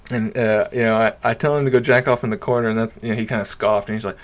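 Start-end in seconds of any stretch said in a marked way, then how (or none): none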